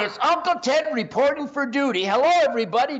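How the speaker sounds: high, loud countdown and greeting; hyped show opening. Real, not acted. Host opens show to everybody, hyped. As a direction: burst in like a hype man.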